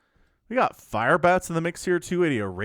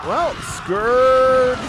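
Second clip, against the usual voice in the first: high, loud, drawn-out call; excited call; 9 semitones higher, 5 dB louder than usual. Real, not acted. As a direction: sing out the unit name at the big moment. Caster reacts to a Scourge attack.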